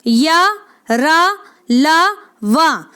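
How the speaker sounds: या, रा, ला, वा drawn out very slowly, each syllable held; teacher slowly sounding out या, रा, ला, वा; slow syllable drill. Shown as a demonstration. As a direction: stretch each of या, रा, ला, वा slowly so students can copy. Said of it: "ध्यान दें।".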